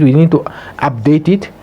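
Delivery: ordinary talk, nothing marked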